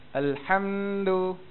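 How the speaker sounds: "alhamdu" with "ham" dragged out far too long; overlong syllable. Shown as a demonstration. Teacher shows students a mistake, overlong "ham" in "alhamdu". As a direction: stretch the "ham" in "alhamdu", very slowly. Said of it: "dia panjang pula".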